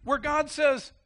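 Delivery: ordinary delivery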